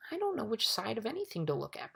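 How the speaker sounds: mildly bewildered protest. A narrator's reading. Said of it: "protested Milo".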